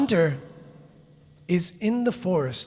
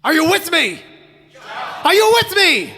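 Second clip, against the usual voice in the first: high, loud shout to the crowd; rally the audience with a big yell; calling out; 10 semitones higher, 8 dB louder than usual. Real, not acted. Calls out to the audience.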